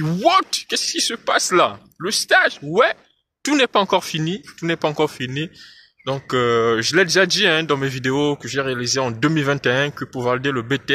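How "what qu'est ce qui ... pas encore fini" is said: a stunned student hearing bad news; surprised. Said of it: "Ils se sont dit".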